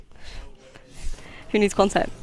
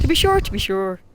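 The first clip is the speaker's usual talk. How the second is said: a weak, lightly put-on Irish accent; bad Irish accent. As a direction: ham up a stage-Irish catchphrase, barely changing the voice. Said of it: "that was my Irish accent".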